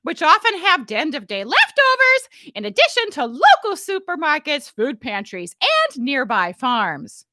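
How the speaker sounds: high, loud, slow announcer voice; broadcaster read. Shown as a demonstration. Loud hammed-up news read. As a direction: she reads her email like a broadcaster. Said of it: "You can read it like a broadcaster".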